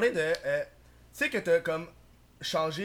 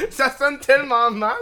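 laughing; 7 semitones higher, 14 dB louder, slower than usual. Real, not acted. Laughing at own remark.

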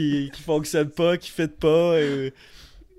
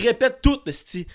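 imitating father; 7 semitones higher than usual. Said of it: "Il dit".

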